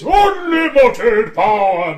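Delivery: loud, slow villain cry; Palpatine impression. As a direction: bellow it like a cackling emperor. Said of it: "Emperor Palpatine".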